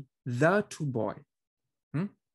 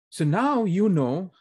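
so now you know, said louder and slower; louder emphatic; 5 semitones higher, 7 dB louder, slower than usual. Real, not acted. Teacher grabbing the class's attention, louder and slower.

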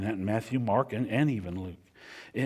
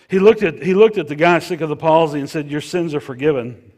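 much higher and much louder, fast proclamation; rising preaching; 6 semitones higher, 14 dB louder than usual. Acted. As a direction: lift the story to a peak. Preacher retelling Jesus healing.

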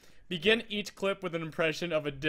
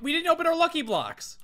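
excited outcry; 10 semitones higher than usual. Real, not acted. Sudden remembering outcry.

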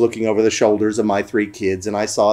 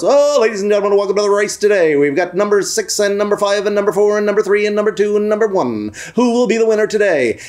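horse race announcer; 8 semitones higher than usual. Retold or imitated. Man on the microphone welcoming the race crowd, announcer voice. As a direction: race caller on a held high pitch. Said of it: "sounded just like a real horse".

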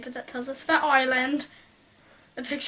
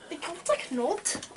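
bad Irish accent, slow; a clumsy stab at Irish; 2 semitones higher, 8 dB quieter, slower than usual. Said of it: "That was the worst Irish accent in the world".

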